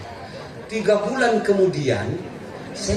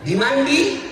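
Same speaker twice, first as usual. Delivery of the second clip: ordinary delivery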